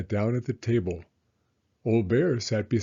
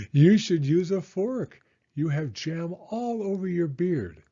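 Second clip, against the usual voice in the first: put-on higher, bright old grandmother voice; Baba Brena; 7 semitones higher, 3 dB louder than usual. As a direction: play the kindly old hostess, pitched up. Baba Brena scolding gently.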